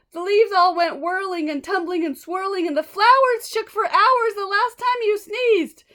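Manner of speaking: high, loud pleading monkeys; chattering monkeys. Acted. Monkeys complaining to the elephant, high and loud. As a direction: rattle off their complaint, pleading.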